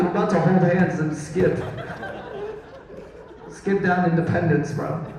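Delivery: a hint of frolicking whimsy; whimsical bro. Comedian insisting to crowd he's just whimsical, playful.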